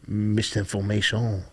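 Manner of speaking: "Misinformation" in a lightly put-on French accent, the stress at the end. Shown as a demonstration. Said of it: "That's how you would say it with a French accent".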